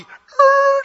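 loud, high imitated brake screech; screeching-halt noise. Donkey suddenly stops under Balaam, high loud screech.

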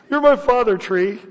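mock the idol worshippers. Mocking paraphrase, raised voice.

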